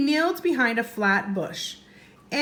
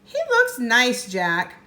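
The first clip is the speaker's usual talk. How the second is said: 1 delighted; 2 semitones higher, 5 dB louder, slower than usual. Acted. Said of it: "Annie replying sweetly".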